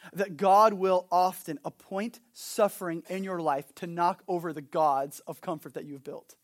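usual speaking voice

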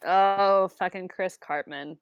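ordinary delivery

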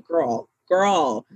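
ordinary talk, nothing marked

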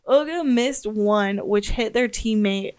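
ordinary delivery

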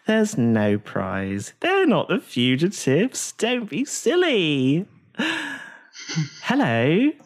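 slow, airy, sing-song voice; a serene beauty gently dismissing the fuss; dreamy, very slow and soothing; sweet Aasimar woman. Acted. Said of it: "the Aasimar woman ... says".

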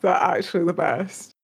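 ordinary delivery

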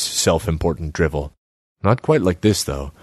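plain reading voice

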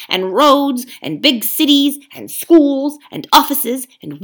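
loud, pushy ape lecturing the beasts; a sly ape bullying the crowd; scheming ape. Acted. Ape haranguing the gathered beasts, loud and pushy.

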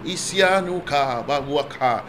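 soft steady prayer in tongues; speaking in tongues. Real, not acted. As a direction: pray quietly in the spirit. Praying in tongues.

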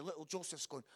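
ordinary talk

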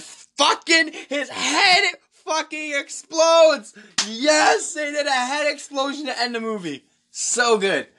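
high, gleeful exclaiming; thrilled outburst. Real, not acted. Reviewer relishing the head explosion ending, thrilled high outburst. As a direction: blurt out the climax, voice soaring with delight. Elated climax of movie retelling.

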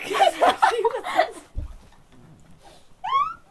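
high, loud, long laughing fit; helpless laughter. Real, not acted. Cracking up at her own troll story, helpless laughter. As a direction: collapse into high-pitched laughter.

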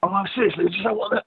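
a teen ordering chips in a put-on British accent; fake British accent. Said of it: "with a fake accent".

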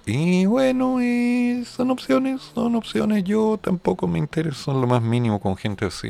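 Susan's voice. Retold or imitated. Host imitating Susan, very high prim put-on voice. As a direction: squeak it like a prim lady. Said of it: "Susan me dice con esa voz".

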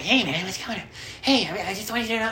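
high, nervous mock fanboy voice; awkward fan. Acts awkward fan greeting musician.

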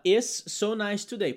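slow learner's phrase; mock learner. Teacher mimics a student translating literally, slow. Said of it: "Ele vai falar".

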